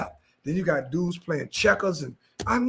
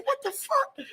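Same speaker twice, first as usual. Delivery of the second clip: much higher, squeaky disbelief; stunned disbelief; 4 dB quieter, slower than usual. Retold or imitated. Reacting to men running the street, high stunned disbelief. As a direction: squeal it in shock.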